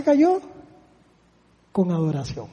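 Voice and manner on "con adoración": very soft and low; soft, low, reverent; hushed. Acted. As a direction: drop to a near hush for reverence. Preacher repeats phrase softly.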